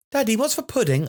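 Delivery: put-on high child's question. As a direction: ask high like a small kid. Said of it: "child asking dad".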